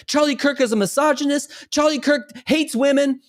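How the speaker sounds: high, put-on voice of an opponent; mimicked accuser. Woman at the gym making accusations, high mimicked voice.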